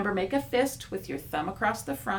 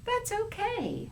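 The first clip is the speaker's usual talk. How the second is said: higher, slow, kind reply; gentle; 13 semitones higher, slower than usual. Acted. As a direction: reassure him gently.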